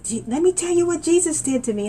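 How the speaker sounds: high, eager call to strangers; high excited witness to bus riders. Retold or imitated. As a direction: share the news bursting with joy. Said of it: "turn around and say".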